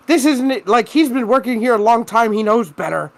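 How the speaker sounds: mock indignant scolding; imitating angry mom. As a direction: protest like a fed-up mom. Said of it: "she was fucking angry".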